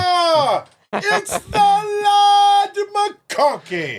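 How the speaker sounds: very high, drawn-out Scottish cry; hammed-up Scottish brogue, stretched slowly; mock Scottish accent. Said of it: "that's my Scottish accent".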